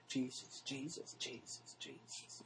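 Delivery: soft chanted Jesus, fading into a whisper; mock mantra prayer. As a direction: imitate a droning mantra that dies away. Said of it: "they're saying Jesus five or six or seven times".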